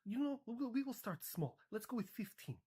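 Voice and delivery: high-pitched mock Polish accent. Poland shops for weapons, in a high mock Polish accent. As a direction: order eagerly in a put-on Polish accent. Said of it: "Ah, Poland".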